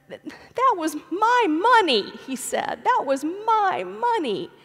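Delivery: put-on high, slow tearful whine of a toddler; mock sobbing child. Preacher voices her toddler sobbing to his father, high and slow. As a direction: whimper it like a three-year-old between sobs. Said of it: "between sobs".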